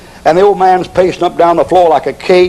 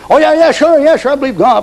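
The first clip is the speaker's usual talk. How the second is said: much higher, jittery yes-yes; nervous eager husband; 9 semitones higher than usual. Acted. Worried husband to wife, nervous and much higher. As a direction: overeager protest of faith, pitched way up.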